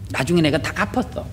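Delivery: usual speaking voice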